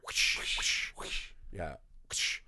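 soft breathy whip whooshes; whip sounds. Retold or imitated. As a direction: imitate a whip cracking, under the breath. Wife whipping him in a bit.

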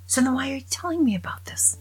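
priest voice. Acted.

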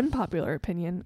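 normal talking voice

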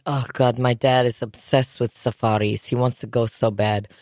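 imitating cousin. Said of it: "She's like".

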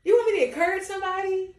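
fast, high and eager; quick, high, eager to serve; eager yes. Pretend. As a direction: rattle it off like an eager helper. Excited mock eagerness.

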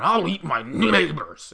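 slow, loud outboard-motor growl; Alex Jones. Acted. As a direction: drag it out in a guttural whiskey-and-cigars voice. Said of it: "outboard motor filled with whiskey and cigars".

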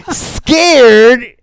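high, loud, scoffing mock of the lady; mimicking an amused office lady; incredulous echo. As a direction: toss the word back in disbelief. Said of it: "the lady goes".

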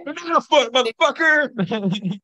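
high, loud, drawn-out playful holler; mock battle yell. Co-host reenacting the banana throw at a driver, gleeful mock yell. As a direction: holler it like a cartoon attack cry.

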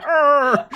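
loud, high grunting "arr"; Little Britain impression. Mocking the co-host's accents, loud yelped impression. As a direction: yelp out a crude character noise. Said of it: "the fucking guy from Little Britain being like".

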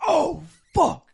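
high, loud shocked curse; retold scream. Telling friends his reaction to the first death, retold scream. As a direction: cry it out, reenacting the shock. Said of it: "I screamed".

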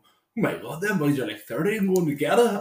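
rising, high, loud teenage mimicry; imitated young fella. Young fella remarking on men walking past, mock scandalised. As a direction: mimic a scandalised lad, climbing in pitch. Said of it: "you just hear him go".

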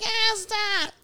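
high mock-protest squeal; imitating laughing pupils. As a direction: mimic a kid begging to stop, high-pitched. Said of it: "they laugh. Some of them are like".

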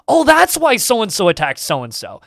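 excited mock epiphany, high then falling; sudden realization. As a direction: play a player suddenly piecing the lore together. Acting out a player's sudden realization.

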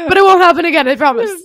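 playful but earnest promise to never repeat it; emphatic promise. Acted. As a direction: insist on it like swearing to the boss.

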